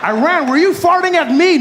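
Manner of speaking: high, indignant interrogation; hammed-up bully demanding an answer; mock outraged accusation. America confronts Iran over a fart, mock outrage.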